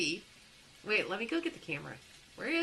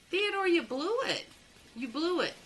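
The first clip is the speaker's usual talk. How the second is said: mock scolding; 8 semitones higher than usual.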